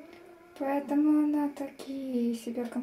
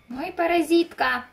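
raised, louder scolding; louder exclaim; 4 semitones higher, 7 dB louder than usual. Real, not acted. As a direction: scold the cat out loud.

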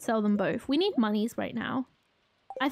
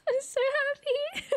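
much higher laughing squeal of joy; joyful squeal; 12 semitones higher than usual. Real, not acted. Player thrilled with new carrots, laughing squeal. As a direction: laugh it out in pure delight.

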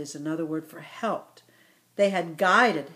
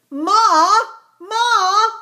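excited cry; 12 semitones higher, 11 dB louder than usual. Acted. Ibex child calling mother.

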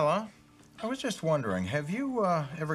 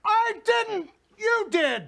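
very high, loud blaming shout; yelling; 6 dB louder, slower than usual. Acted. Father blames daughter for the milk order, yelling. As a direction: throw the blame back, loudly. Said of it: "why are you yelling at me".

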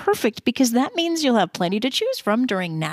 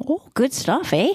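put-on light Canadian accent, rising eh; lightly put-on Canadian accent; 3 semitones higher than usual. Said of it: "You didn't catch my Canadian accent?".

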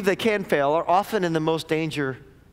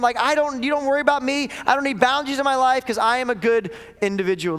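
higher, insistent protest of a proud disciple; overconfident Peter; 6 semitones higher than usual. Retold or imitated. Peter insisting to Jesus he will never fail, raised earnest protest. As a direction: plead your own goodness, a bit raised and earnest. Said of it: "Listen to what Peter says".

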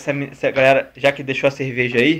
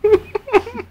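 short high giggle; high laugh. Real, not acted. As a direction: giggle at the mishap. Laughs at friend's failure.

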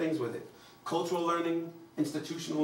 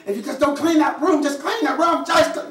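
put-on high, loud exasperated yell at a kid; yelling parent; 12 semitones higher, 9 dB louder than usual. Parent yelling at child to clean room, loud exasperated. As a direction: shout it up like a fed-up mom.

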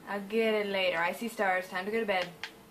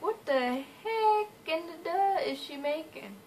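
higher mock-baffled voice; imagined puzzled viewers; 7 semitones higher than usual. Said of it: "Y'all are like".

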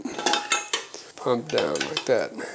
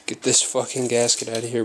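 ordinary talk, nothing marked

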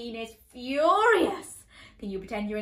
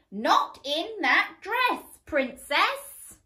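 put-on loud, slow, higher call for the kids; loud refrain; 7 semitones higher, 5 dB louder, slower than usual. Shown as a demonstration. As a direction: chant it so everyone joins. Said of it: "You say it with me".

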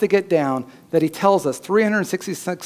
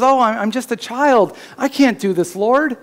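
put-on high, raised voice of a frightened young prophet; pleading Jeremiah; 7 semitones higher than usual. Preacher voicing Jeremiah protesting to God, high and pleading. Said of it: "When Jeremiah says".

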